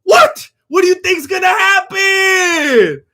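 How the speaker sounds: high, loud, slow disbelieving yell; what were you thinking, shouted slowly; exasperated shout. Real, not acted. Streamer yelling at the fighter on screen, loud and slow. Ranting at fighter's careless entrance.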